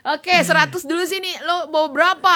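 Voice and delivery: suara preman yang minta duit, agak tinggi; preman memalak. Acted. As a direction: malak dengan nada tinggi dan agak keras. (preman malak uang dengan suara dinaikkan)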